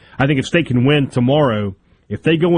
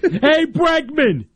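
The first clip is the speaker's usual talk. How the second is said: drawn-out Boston heckler call, pitched up; Boston accent; much slower than usual. Retold or imitated. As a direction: call out slowly like a Boston fan. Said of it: "I can hear the Boston accent there".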